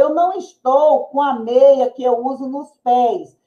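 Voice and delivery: ordinary talk, nothing marked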